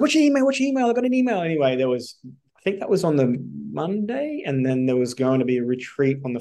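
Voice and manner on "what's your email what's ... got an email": very high, urgent Irish impersonation; Irish mentor. Retold or imitated. Mentor demanding email over the phone, imitated Irish accent. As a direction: fire it off in a mimicked high Irish voice. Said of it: "he's Irish".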